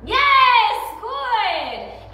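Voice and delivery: very high, loud yes; excited praise. Real, not acted. Trainer praising her dog the instant he gets it right, very high and loud. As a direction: burst out with the reward marker. Marking dog's behavior.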